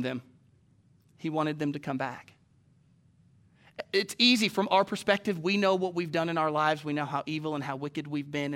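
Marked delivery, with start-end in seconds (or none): none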